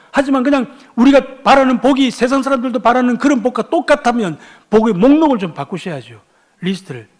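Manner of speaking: high, forceful rhetorical questioning; impassioned preaching. Real, not acted. Preacher asks congregation who can truly bless, impassioned preaching. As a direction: fire challenges at the congregation.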